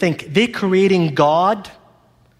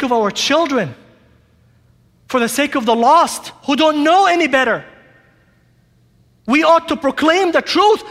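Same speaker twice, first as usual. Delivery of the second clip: preacher pleading with his church to proclaim the whole truth, fervent and raised; preaching at full force; 7 semitones higher than usual. Acted. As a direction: rouse the church like a herald warning the lost. Impassioned preaching climax.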